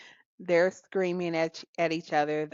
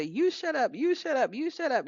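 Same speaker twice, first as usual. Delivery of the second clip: mock bickering kids; 8 semitones higher than usual. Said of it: "telling each other".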